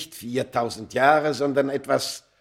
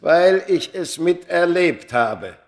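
higher, louder, slow insistence; intense declaration; 8 semitones higher, 10 dB louder than usual. Acted. The man insisting to Smith he lived it, loud slow. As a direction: press every word home.

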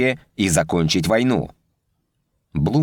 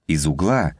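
ordinary delivery, nothing marked

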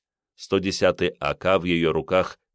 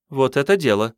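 perked up; 7 semitones higher, 4 dB louder than usual. A narrator's reading. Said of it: "оживилась Катрин".

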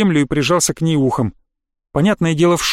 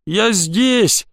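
higher, plaintive call; plaintive whimper; 4 semitones higher than usual. A narrator's reading. Trapped boy answering his friend, plaintive. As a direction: call out pitifully from below. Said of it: "послышалось жалобное хныканье".

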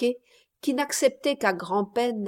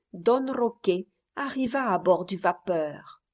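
usual speaking voice

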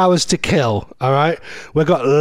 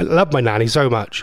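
ordinary delivery

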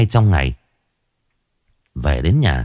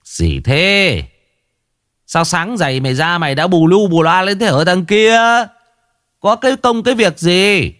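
put-on higher, sharp scolding old man; irritated scolding; 10 semitones higher than usual. Old man scolds the neighbour boy for shouting, higher and irritated. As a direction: snap at the boy for the racket.

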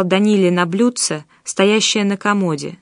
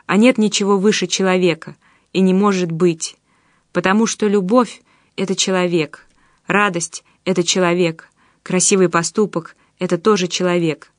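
plain reading voice

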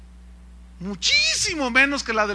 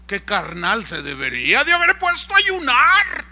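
indignant legalist; 3 semitones higher, 7 dB louder than usual. Acted. Legalist judging Peter for being hungry, mock indignation. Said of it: "diría el legalista".

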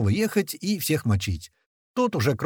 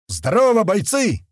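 high, loud greeting to the troops; blurted shout; 9 semitones higher, 6 dB louder than usual. A narrator's reading. Unprepared chief greets the lined-up fighters, blurted shout. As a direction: blurt it out loudly at a whole formation. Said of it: "ляпнул я первый, что пришло мне на ум".

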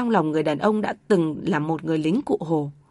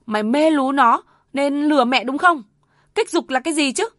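scornful accusation; 6 semitones higher, 5 dB louder than usual. Acted. Mother accuses son, scornful.

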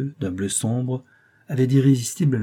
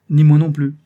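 curt retort; 5 semitones higher, 10 dB louder than usual. Acted. Couturier curtly dismisses debtor.

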